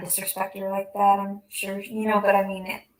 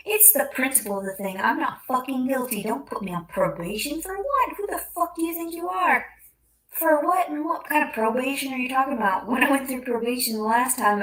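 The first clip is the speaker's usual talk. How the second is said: louder, higher outraged protest; indignant rant; 4 semitones higher, 4 dB louder than usual. Real, not acted. Venting to the camera about probation, indignant and loud. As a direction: rant at the injustice.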